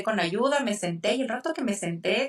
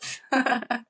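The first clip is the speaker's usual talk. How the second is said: a short laugh breaking into tears; tearful laugh; 5 semitones higher than usual. Real, not acted. Mother recalling her twins' first kiss, a tearful laugh. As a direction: laugh through tears, overwhelmed.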